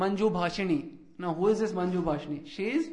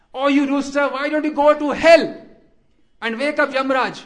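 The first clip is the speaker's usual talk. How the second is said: much higher, loud mock rant at the rooster; angry outburst; 6 semitones higher, 12 dB louder than usual. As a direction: scold the rooster at full volume. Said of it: "she gets angry. She says".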